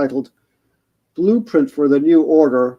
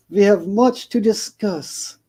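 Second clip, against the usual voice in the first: put-on slow, smiling accented voice, a touch higher; cryptic; 6 semitones higher, slower than usual. Shelob hints at her plans to Frodo, cryptic and smiling. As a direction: let it hang with a knowing smile. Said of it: "said Shelob with a cryptic smile".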